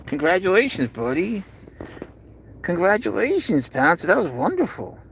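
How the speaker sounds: high, sing-song pet praise; cooing congratulations. Real, not acted. Owner celebrates cat's first hiss, high cooing praise.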